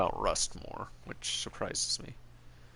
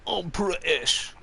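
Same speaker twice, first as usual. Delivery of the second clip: hammed-up British accent, higher and louder; mock British accent; 6 semitones higher, 4 dB louder than usual. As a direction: play a cartoon Brit in a broad British accent. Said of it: "be like".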